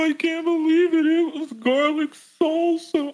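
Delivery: very high, gushing imitation; hammed-up high-pitched babbling; weird embarrassed voice. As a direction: gush in a squeaky put-on date voice. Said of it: "had the weirdest voice".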